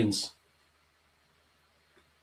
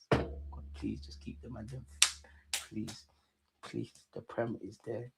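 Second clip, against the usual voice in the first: much softer, hushed pleading to God; quiet prayer; 3 semitones lower, 10 dB quieter than usual. Real, not acted. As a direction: beg under your breath, almost silent. Praying for the title.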